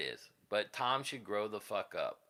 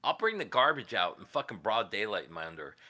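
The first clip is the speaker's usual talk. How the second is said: ordinary talk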